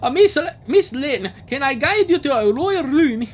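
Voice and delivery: a booming Scottish host, overjoyed. Acted. Scottish Angus greeting returning royalty, loud and hammed up. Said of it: "do the Scottish voice for this guy".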